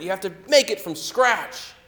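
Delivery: loud high furious shout; furious yell. Retold or imitated. Father yelling at the manager, loud furious shout. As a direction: bellow the demand at the manager. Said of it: "He starts yelling at the manager".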